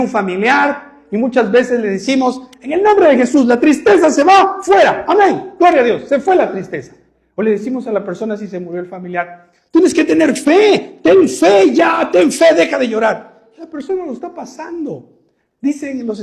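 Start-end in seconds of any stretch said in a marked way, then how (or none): 2.6-6.9 loud, higher mock rebuking of sadness in Jesus' name; hammed-up preacher casting out the sadness; mock rebuke shout. Mocking churchgoers yelling at a grieving person, loud mock shout. 9.7-13.5 slow, loud, higher mock command to have faith; mock shout. Churchgoer ordering a grieving person to have faith, slow mock shout.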